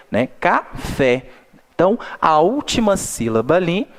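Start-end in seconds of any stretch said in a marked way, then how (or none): 0.4-1.3 Café in two parts, ca-FÉ; stress on last syllable. Shown as a demonstration. Teacher repeats the example word, stressing FÉ. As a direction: separate Café and lean on FÉ. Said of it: "pronunciei um pouco mais forte o fé".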